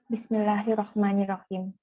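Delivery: ordinary talk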